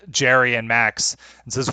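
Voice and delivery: usual speaking voice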